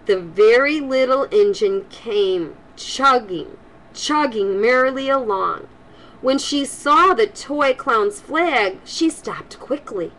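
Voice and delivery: lively storybook read-aloud voice; reading to children. Acted. Grandma reading a storybook to kids, lively and warm. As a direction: read it brightly to the little ones.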